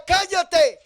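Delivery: loud, high mock shout to shut up. Crowd shouts at the blind man to shut up, loud and high. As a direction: bark it at him. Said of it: "Y la gente le decía".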